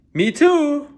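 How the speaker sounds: high, bright little chick cry. Acted. The chick crying out to the duckling, high and eager. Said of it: "cried the chick".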